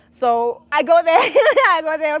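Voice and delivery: laughing. Real, not acted. Laughing retelling.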